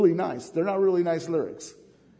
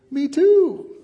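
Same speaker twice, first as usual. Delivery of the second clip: higher and louder, thrilled to share the news; excited me too; 12 semitones higher, 9 dB louder than usual. Shown as a demonstration. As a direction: burst out with joy, like you're going too. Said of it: "if I said it like this".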